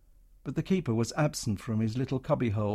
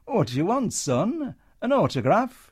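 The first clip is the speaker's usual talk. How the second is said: higher, sugary false friendliness; wheedling; 8 semitones higher, 5 dB louder than usual. Acted. Comedian sweet-talking the soldier, wheedling. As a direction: switch on the charm for the uniform. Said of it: "a wheedling smile".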